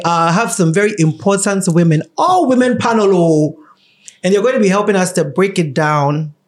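usual speaking voice

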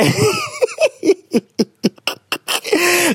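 long, high laughing fit; helpless giggling. Real, not acted. Host breaks up introducing the next letter, laughing helplessly.

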